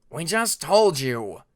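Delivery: loud, slow, furious snap; angry snap. Acted. Prince snapping at doubting Alligator, loud and angry. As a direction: bite his head off. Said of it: "Cliff snapped at Alligator".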